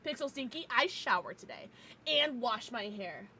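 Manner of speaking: usual speaking voice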